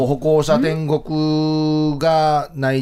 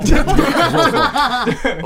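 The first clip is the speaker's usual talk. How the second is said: laughing; 7 semitones higher, slower than usual. Real, not acted.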